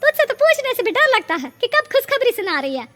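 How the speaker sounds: calling out. Acted. Neighbour calling out teasingly.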